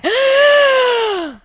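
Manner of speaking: huge high shouted gasp, surfacing from water; loud gasp after nearly drowning; desperate gasp for air. Acted. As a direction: gulp air like you almost drowned. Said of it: "and he's gasping, going".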